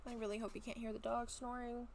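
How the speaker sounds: ordinary talk, nothing marked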